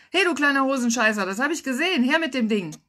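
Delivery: loud, high, rapid telling-off; scolding shout. Acted. Lifeguard to a little boy with a water pistol, scolding shout. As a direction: bark it at a naughty kid. Lifeguard yells at misbehaving child.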